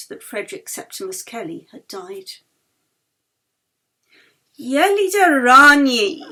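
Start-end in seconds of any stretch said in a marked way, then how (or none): 4.6-6.2 loud, slow, grand announcement; booming theatrical welcome; mock ceremonial greeting. A narrator's reading. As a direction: proclaim her name like a herald at a ball. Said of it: "with a mock ceremonial bow".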